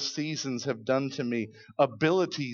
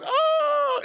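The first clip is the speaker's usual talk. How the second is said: high panicked yelp; frightened disciples crying out; 7 dB louder than usual. Retold or imitated. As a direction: yelp in fright as the boat tips. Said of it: "Disciples are just like".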